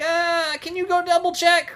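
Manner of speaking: high-pitched put-on hesitant request; reluctant customer.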